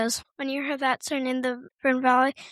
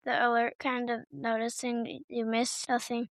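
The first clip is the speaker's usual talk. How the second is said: plain reading voice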